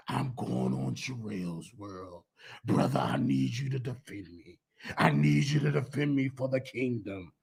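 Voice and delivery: deep hushed begging; Larry Reed pleading. Retold or imitated. Imitating the doctor asking for defense, low and soft. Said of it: "get you back on the phone".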